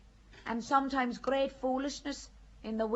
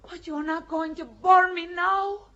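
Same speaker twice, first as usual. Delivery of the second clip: alarmed outcry; 3 semitones higher, 9 dB louder than usual. Acted. Joan realising she will be burned, alarmed cry. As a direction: blurt it out in shock.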